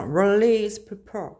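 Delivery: slow, showy English accent on "Release paper"; jokingly exaggerated English accent on "Release paper". Shown as a demonstration. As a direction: drawl "Release paper" like a native English speaker, stretching each word. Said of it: "Vous avez vu mon accent?".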